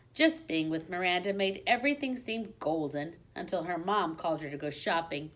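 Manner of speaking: plain reading voice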